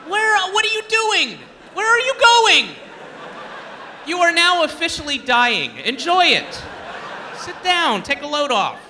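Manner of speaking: high, loud, mock-urgent scolding; high loud mock alarm; mock shouting at junkies. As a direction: yell at them to sit down, hammed up. Addressing junkies in the park.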